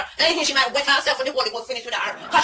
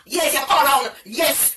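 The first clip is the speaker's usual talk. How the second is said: ordinary talk, nothing marked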